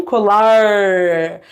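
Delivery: COLAR with a very long, low curled-tongue R; retroflex R. Shown as a demonstration. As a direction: exaggerate and hold the retroflex R in COLAR. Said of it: "A língua bem lá em cima".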